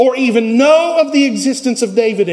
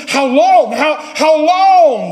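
drawn-out cry of how long, higher and louder; anguished plea; 6 semitones higher, 4 dB louder, slower than usual. Acted. Preacher voicing David crying out to God in anguish. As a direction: ache it out, stretching the second how long. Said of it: "he asks".

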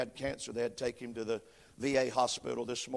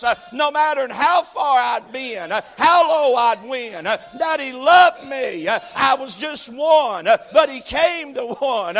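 louder, higher preacher's cadence with "uh" breaths; full-force preaching; 10 semitones higher, 13 dB louder than usual. Acted. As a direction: build it up like a revival preacher.

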